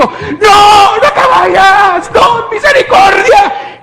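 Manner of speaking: high, loud, slow crying cry of panic; terrified pleading shout. Retold or imitated. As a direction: beg him not to leave, voice breaking. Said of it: "y le grito".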